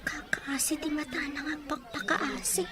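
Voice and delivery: breathy whisper with a little laugh, said to herself; whispered aside. Acted. Young woman musing aside on the pleading man, whispered and amused. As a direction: keep it under your breath, amused.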